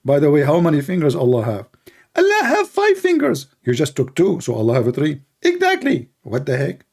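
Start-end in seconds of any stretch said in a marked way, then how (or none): 2.1-3.5 much higher mock preacher cursing; squeaky parody of an angry preacher; Ali Dawa. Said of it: "Ali Dawa". 5.4-6.1 much higher mock preacher cursing; squeaky parody of an angry preacher; Ali Dawa. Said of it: "Ali Dawa".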